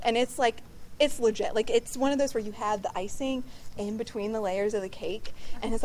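normal talking voice

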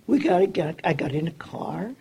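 normal talking voice

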